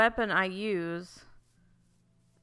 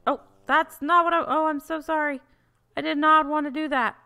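high, loud horrified apology; real pitched-up sorry; high apologetic dismay; 8 semitones higher, 5 dB louder than usual. Real, not acted. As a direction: yelp sorry after hitting the villager.

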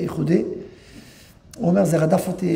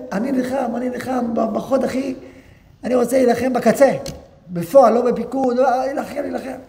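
higher, eager pleading voice; passionate insistence; 6 semitones higher than usual. Retold or imitated. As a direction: insist on the front line. Soldier insists on fighting. (בן מתעקש בלהט להילחם בחוד)

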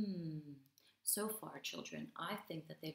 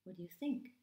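ordinary delivery

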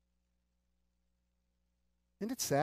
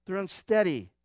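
ordinary talk, nothing marked

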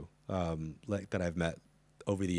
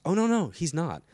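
higher, louder quick contradiction; emphatic denial; 10 semitones higher, 6 dB louder than usual. Retold or imitated. Producer correcting the host about the husband, emphatic denial. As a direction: imitate someone rushing to correct a wrong idea.

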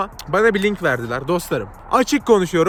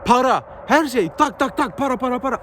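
exasperated emphasis; 5 semitones higher than usual. Real, not acted. Chanting money money.

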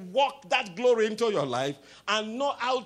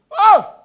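high shouted exclamation; sudden loud oh; 12 semitones higher, 16 dB louder than usual. Real, not acted. Preacher breaks off to congregation, high loud cry. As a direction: burst out with a sharp oh.

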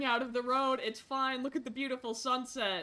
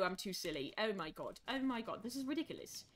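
normal talking voice

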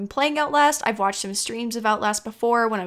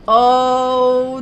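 loud, long drawn-out "oh"; real jolt of dismay, held out loud; startled; 11 dB louder than usual. Real, not acted. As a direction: cry out as it goes wrong. Startled as the gate closes.

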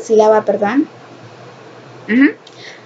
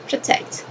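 "protect" as pro-TECT, much softer; hushed second try at pro-TECT; stress on second syllable; 10 dB quieter than usual. Shown as a demonstration. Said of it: "the accent".

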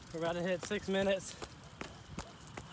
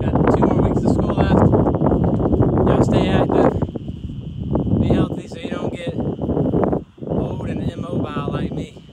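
loud; 14 dB louder than usual. Real, not acted. Exhausted, shouting goodbye to viewers.

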